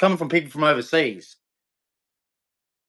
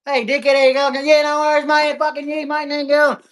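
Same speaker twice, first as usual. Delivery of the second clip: higher-pitched larrikin digger voice, matey and cheeky; Anzac digger banter; 9 semitones higher than usual. Retold or imitated. Ranting Aussie showing how the Anzacs talked, higher larrikin voice. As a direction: imitate old diggers greeting mates with cheerful insults. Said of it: "That's how the Anzacs talked".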